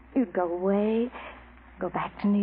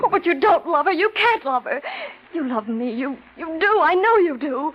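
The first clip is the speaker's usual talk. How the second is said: passionate pleading; 6 semitones higher, 4 dB louder than usual. Acted.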